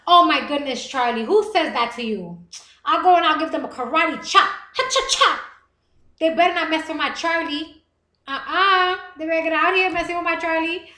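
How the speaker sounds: loud, playful protective indignation; mock outrage. Streamer playfully defending insulted viewer, mock outrage.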